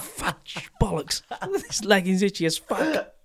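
a hint of someone grumbling in the loft insulation; mock loft intruder. Joking about the person hiding in the loft, lightly voiced. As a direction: play the hidden intruder cursing the itchy lagging.

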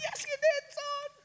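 very high, cracked excited squeak; no voice left. Retold or imitated. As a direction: answer thrilled, with your voice gone. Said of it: "we had no voice".

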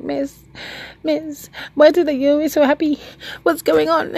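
high, eager student voice; curious student. Retold or imitated. Student asking teacher why she is always happy, high and curious. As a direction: ask it like a puzzled pupil. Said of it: "one of the students actually came up to me".

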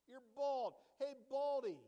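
loud playground jeer; mocking a bald man, loudly jeering; mock taunt. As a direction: hurl the insult like a kid. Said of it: "Man, that hurts".

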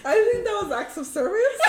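talking through big laughter; words breaking into loud laughter; laughing. Real, not acted. Friends cracking up over an awkward moment, laughing.

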